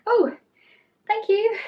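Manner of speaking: high, loud startled thanks; surprised thank you. Retold or imitated. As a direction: caught off guard, squeak it out. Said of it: "I was like".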